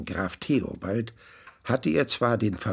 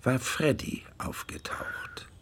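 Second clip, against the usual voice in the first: hushed narration; 7 dB quieter, slower than usual. A narrator's reading.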